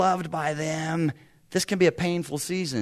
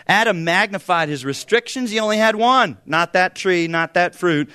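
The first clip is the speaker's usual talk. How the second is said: preacher pounding the point, louder; emphatic loud; 5 semitones higher, 8 dB louder than usual. Acted. Preacher to church about Adam's one tree, loud emphatic. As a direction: hammer each word out.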